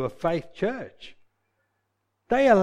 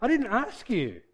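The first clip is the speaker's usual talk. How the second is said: firm rebuke; 7 semitones higher than usual. Pretend. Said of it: "you train yourself and you say".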